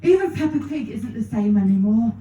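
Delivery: ordinary talk, nothing marked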